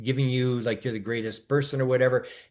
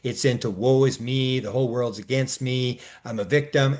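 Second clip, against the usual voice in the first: normal talking voice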